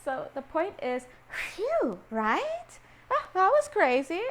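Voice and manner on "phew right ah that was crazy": high, loud, acted relief; exaggerated relief. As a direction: blow out relief, then exclaim high. Acts out relief after near miss.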